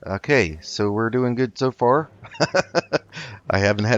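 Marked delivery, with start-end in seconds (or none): 2.1-3.4 a quick bright laugh mid-fight; brief amused laugh; short laugh. Real, not acted. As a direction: laugh it off, pleased. Laughs during combat.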